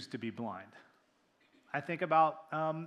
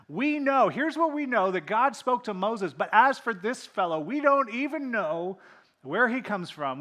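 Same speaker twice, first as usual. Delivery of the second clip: higher, louder, indignant scoffing; scornful insults; 5 semitones higher, 11 dB louder than usual. Retold or imitated. Pharisees insulting the formerly blind man, scornful. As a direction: sneer down at him from authority.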